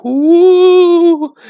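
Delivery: very high, slow, eerie ghostly wail; ghost crying. A narrator's reading. Dead woman's ghost calling for her money, high eerie wail. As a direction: moan like a far-off ghost hunting her money. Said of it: "the voice way off cried".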